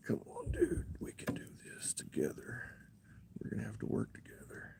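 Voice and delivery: very soft, voiceless whisper; coaxing whisper. Real, not acted. Angler urges hurt fish to work together, whispering. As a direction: coax it along under your breath. Coaxes the hooked fish while handling it.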